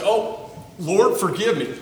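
a mock contrite appeal to God; pleading prayer. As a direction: beg the Lord for help, earnestly. Acted prayer asking forgiveness.

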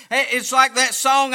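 normal talking voice

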